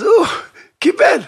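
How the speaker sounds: high, loud burst; excited telling. A narrator's reading. Storyteller announcing the son accepted, high and excited.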